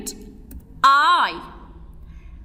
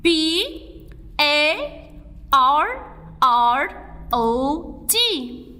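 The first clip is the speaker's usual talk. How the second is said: slow spelling; much slower than usual. Shown as a demonstration. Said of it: "Let's write parrot".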